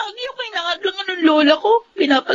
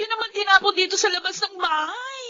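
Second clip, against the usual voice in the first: crying, higher and softer; tearful protest; 5 semitones higher, 6 dB quieter than usual. Acted. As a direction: plead through tears, ashamed. Granddaughter crying, humiliated. (umiiyak na pakiusap)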